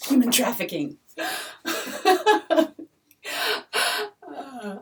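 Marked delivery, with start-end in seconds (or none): 1.2-2.8 a breathy burst of laughter, pitched high; high airy laugh. Real, not acted. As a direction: laugh at your own dark joke. Laughs at her own joke.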